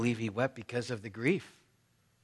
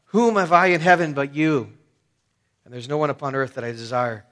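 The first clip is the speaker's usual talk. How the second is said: loud, raised cry of devotion; 9 dB louder than usual. Retold or imitated. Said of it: "that he cries out".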